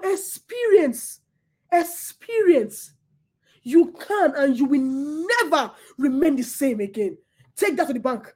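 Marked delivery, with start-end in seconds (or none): 0.0-2.9 experience drawn out slow, each syllable weighed; stress on experience. Shown as a demonstration. Preacher to congregation, slow weighty experience. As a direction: underline experience by saying it slowly. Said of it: "underline that word".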